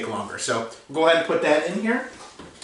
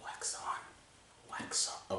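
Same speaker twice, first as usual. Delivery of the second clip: breathy whispered movie quote; whispering; 21 dB quieter, much slower than usual. Pretend. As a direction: whisper it like a mentor.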